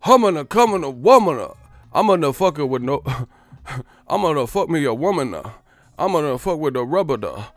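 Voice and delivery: loud slurred nonsense rap, hammed up; drunk mumble rapper. Parodying a rapper who sounds drunk, loud slurred rap. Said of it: "You sound drunk on the whole album".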